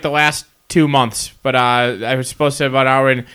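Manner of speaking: normal talking voice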